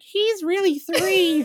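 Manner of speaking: put-on very high, loud, drawn-out kid voice; older kids tattling. Older kids exposing brother's age at Disney, tattling. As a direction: blurt it out like a tattling child. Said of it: "the older kids would be like".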